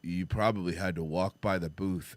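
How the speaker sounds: low dull clerk voice; dopey attendant. Retold or imitated. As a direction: play him dim and puzzled.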